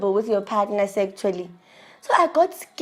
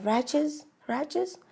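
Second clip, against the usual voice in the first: talking to herself; 6 semitones higher, 5 dB quieter than usual. Retold or imitated. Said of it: "And then I said to myself".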